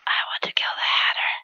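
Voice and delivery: breathy secret whisper, no voice. Shown as a demonstration. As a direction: hush it like a dark confession. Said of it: "whisper your darkest secret into the nut".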